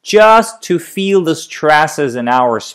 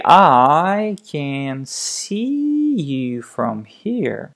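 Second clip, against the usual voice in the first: very slow, syllable by syllable, tailing off much softer on here; slow tapped phrase; 4 dB quieter, much slower than usual. Shown as a demonstration. Coach demonstrating the slow practice pace. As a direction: tap it out slowly, one syllable at a time. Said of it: "So we say".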